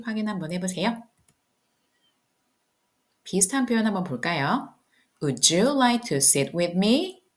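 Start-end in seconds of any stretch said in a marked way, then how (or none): 5.2-7.2 slow, carefully spaced English line; slow model sentence. Shown as a demonstration. Teacher modeling for students, slow and clear. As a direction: read it slowly with "would you" linked. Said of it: "would you라고 해주세요".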